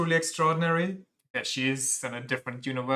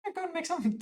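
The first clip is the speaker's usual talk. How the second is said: very high, thin character voice; squeaky high voice; faster than usual. Acted. Streamer voicing a game character, very high. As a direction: push the voice way up into a squeak. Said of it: "this voice is either kidding me and too annoying".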